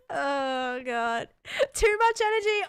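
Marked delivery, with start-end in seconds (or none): 1.7-2.7 incredulous. Real, not acted. Incredulous at chat reply.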